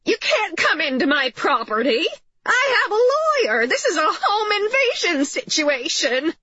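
high, frantic mother shouting threats; shouted threats. A narrator's reading. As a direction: threaten the intruders at a piercingly high pitch. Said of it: "shouting various threats".

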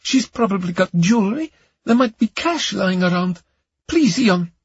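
high-pitched, pleading woman; tearful pleading. Acted. Camellia begs Ion to search the Britons' place, tearful. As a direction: beg him in a high, upset voice. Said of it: "Now she began to cry".

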